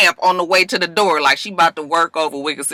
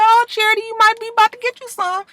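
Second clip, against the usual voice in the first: high, quick teasing call to her; teasing excitement; faster than usual. Real, not acted. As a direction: egg her on with a high, fast tease. Teasing Charity on the show.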